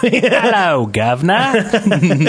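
high, bright mock Cockney greeting; mock British accent. Gamer greets friends in a mock British accent. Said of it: "you started off with".

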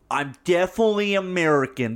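put-on British actor faking an American accent; mock a Brit attempting American; bad American accent. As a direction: overdo the American accent, badly. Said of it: "some of these fucking British people".

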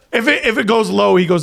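usual speaking voice

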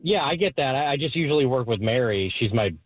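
ordinary delivery

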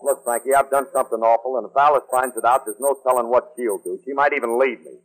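low-pitched worried confession; downcast, in a low register; morose. Acted. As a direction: confess gloomily, dreading the consequences. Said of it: "Are you morose".

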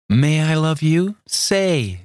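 high, pleading plea; a young woman entreating her love. A narrator's reading. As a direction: beg him sweetly, voice lifted high. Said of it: "she entreated".

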